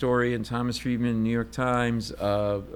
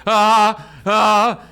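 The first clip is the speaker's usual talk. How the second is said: frozen panic stammer; 9 semitones higher, 10 dB louder than usual. Retold or imitated. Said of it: "I freeze".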